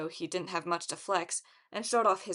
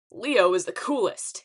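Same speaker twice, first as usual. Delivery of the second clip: loud, swaggering announcement; loud self-promoting call; shouted boast; 8 dB louder than usual. A narrator's reading. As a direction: shout it out cockily to a crowd. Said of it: "he shouted".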